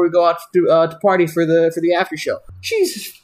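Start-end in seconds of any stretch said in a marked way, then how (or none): 2.6-3.1 a sudden very high exclamation; stunned disbelief. Real, not acted. Hearing that Spicoli drank both shots, high stunned exclamation. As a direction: yelp it out in disbelief. Reacting to two energy shots.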